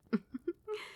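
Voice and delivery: a light airy giggle rising up. Acted. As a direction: giggle fondly at the pet. Doting vampire queen laughing softly.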